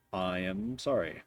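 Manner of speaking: low, subdued game-character voice; low, quiet, solemn apology; apologetic metal ally. Acted. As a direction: apologize formally as a metallic companion, softly. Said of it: "gives you a, uh, metallic shrug, uh, and goes".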